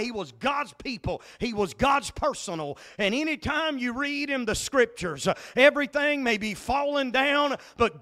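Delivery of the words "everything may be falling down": ordinary talk, nothing marked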